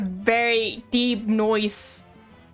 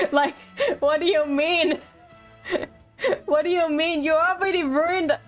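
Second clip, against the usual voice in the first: real laughter breaking into the words; higher, giggly teasing protest; laughing disbelief; 5 semitones higher than usual. Real, not acted. Teasing the game character while laughing.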